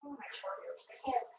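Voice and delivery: whispered groan of exhaustion; pained whisper. Real, not acted. Worn out after walking outside, to herself: pained whisper. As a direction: breathe it out, spent and aching.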